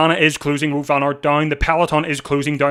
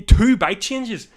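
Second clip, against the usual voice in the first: P=A; stunned disbelief; 7 semitones higher, much slower than usual. Real, not acted. Repeats it in disbelief.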